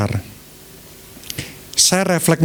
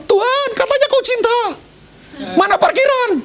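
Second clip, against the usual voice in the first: high, put-on whining voice; whiny complainer. Preacher mimics a believer whining to God about parking, high mock whine. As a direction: complain to God like a spoiled child.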